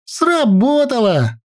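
high, drawn-out happy cry at normal volume; gleeful, stretched-out exclamation; joyful. A narrator's reading. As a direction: celebrate the success in a high girl's voice. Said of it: "радостно сказала Наташка".

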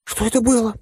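high-pitched startled question; frightened. A narrator's reading. Vasily comes round and asks what happened, frightened. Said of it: "испуганно спросил тот".

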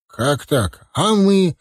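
father left out of the wedding plans; bewildered. A narrator's reading. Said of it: "недоуменно спросил Ахмат". (недоуменно)

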